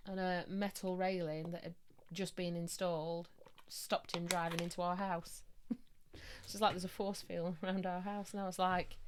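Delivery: normal talking voice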